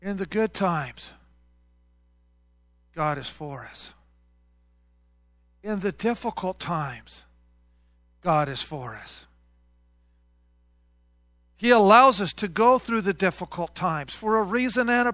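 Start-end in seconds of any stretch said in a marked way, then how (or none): 0.0-9.3 much softer, slow summary; soft recap. Acted. Preacher softly summing up for the church. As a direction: let it settle quietly.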